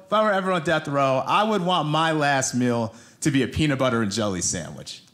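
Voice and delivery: ordinary talk, nothing marked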